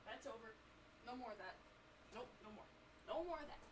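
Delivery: quiet muttering. Real, not acted. Quiet muttering after the reveal.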